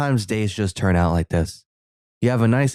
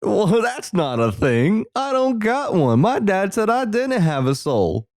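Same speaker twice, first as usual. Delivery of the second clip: put-on light Southern accent; 10 semitones higher than usual. Voicing the on-screen boy, mild Southern accent. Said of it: "this Southern accent thing".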